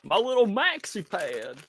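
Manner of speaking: dopey and fond like Goofy. Shown as a demonstration. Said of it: "I got Goofy".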